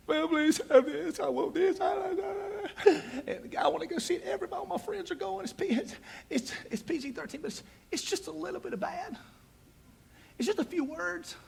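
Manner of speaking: high whiny pleading preteen; begging kid voice. Retold or imitated. Preteen son begging his mom for the movie, high and whiny. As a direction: beg like a kid who wants the movie.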